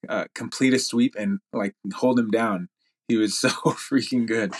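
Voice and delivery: ordinary talk, nothing marked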